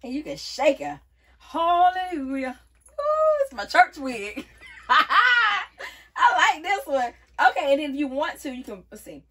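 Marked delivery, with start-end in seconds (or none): 1.4-4.4 loud, drawn-out mock-church rejoicing; playful church praise. Joking to viewers about a church wig, playful praise. 4.7-7.1 loud, high, through real laughter; delighted laughing. Real, not acted. Approving the wig's new style, delighted laughing.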